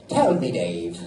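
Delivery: low-pitched old Irishman, badly faked accent; awful Irish accent. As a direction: imitate an old Irish local, poorly. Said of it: "my Irish accent, I know, is awful".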